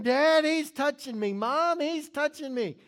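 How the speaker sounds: put-on whiny kid tattling to parents; whiny tattling little sibling. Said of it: "they would be hollering".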